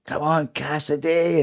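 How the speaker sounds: restrained retold shout of encouragement; imitated cheering shout. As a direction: call out slowly like a spectator, not at full volume. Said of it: "he's shouting".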